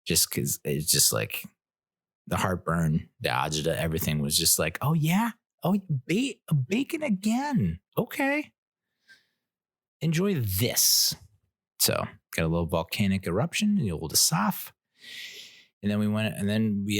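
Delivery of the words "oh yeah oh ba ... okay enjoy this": high mock-cheerful Boston stomach voice, much higher, drawn out at the end; sing-song sarcasm as his own gut; mock-cheerful stomach voice, ending slow; sarcastic stomach. Stomach voiced sarcastically about bacon.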